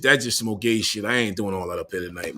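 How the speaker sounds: ordinary talk, nothing marked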